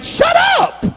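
very high, loud comic outburst; exasperated mock yell. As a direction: yelp it to hush embarrassing friends. Jesus silences showy disciples.

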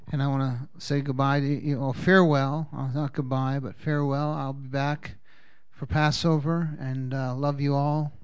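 normal talking voice

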